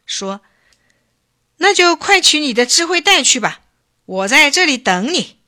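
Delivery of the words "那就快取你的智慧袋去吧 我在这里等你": high, loud, fired-up order; angry. A narrator's reading. Chief flares up at Bacang's boast, angry. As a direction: order him off in a raised, heated voice. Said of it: "一听冒火了".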